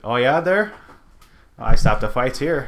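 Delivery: lightly imitating a Minnesota guard; Minnesota accent. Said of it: "slightly less Boston".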